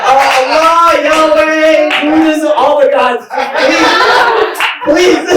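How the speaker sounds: loud, high comic pleading; hammed-up frantic plea to the gods; desperate prayer. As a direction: call out to the heavens, begging. Acted plea to every god.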